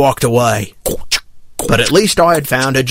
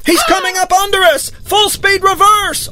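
high, urgent yell; yelled warning. A narrator's reading. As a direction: yell the alarm to the whole crew. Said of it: "I yelled".

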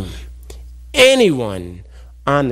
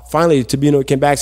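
ordinary delivery, nothing marked

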